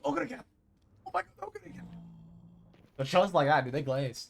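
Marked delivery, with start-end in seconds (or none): 0.0-1.9 high, squealing mock fangirling; hyped anime fans. Streamer mimicking fans glazing in the show. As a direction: gush it like an overexcited fan. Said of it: "They go".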